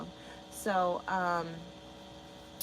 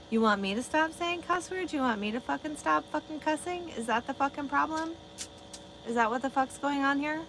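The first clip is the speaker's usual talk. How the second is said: higher, hammed-up indignant rant; mock outrage; 7 semitones higher than usual. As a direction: play it as fake offended outrage. Mock outrage at cursing complaint.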